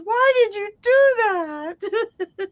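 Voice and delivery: high, slow mock dismay; shocked wife. Wife splattered with ketchup, mock dismay. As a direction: drag it out in disbelief. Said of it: "She goes".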